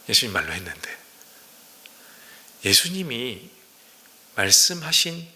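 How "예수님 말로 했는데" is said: low, soft chuckle through the words; amused murmur under the breath; laughing aside. Real, not acted. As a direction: laugh it off quietly. Wry aside after rhetorical question.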